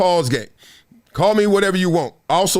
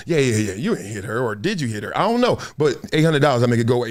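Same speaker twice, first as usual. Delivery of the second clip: low shady guy voice; 6 semitones lower, faster than usual. Retold or imitated. The guy brushes off whether he hit her, low and shifty. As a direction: mumble it low like a shifty fixer. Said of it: "He was like".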